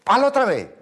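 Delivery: high, loud mock yell of an impatient father. Father yelling at his son keeping rhythm, high-pitched loud yell. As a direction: shout it high like a fed-up dad. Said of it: "me gritaba".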